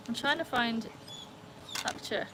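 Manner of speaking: usual speaking voice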